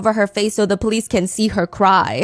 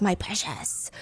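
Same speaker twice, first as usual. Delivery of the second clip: Gollum voice; 3 semitones lower, 13 dB quieter, slower than usual. Retold or imitated. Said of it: "The one that's like".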